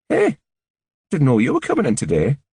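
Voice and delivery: a quick, high, bright Geordie greeting; surprised hello in a light Geordie accent. A narrator's reading. Annie greets Jackman at the door, in a Geordie accent. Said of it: "A Geordie accent".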